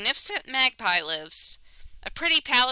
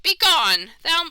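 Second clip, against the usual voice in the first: louder grand command; 7 semitones higher, 5 dB louder, slower than usual. Acted. Magpie voice.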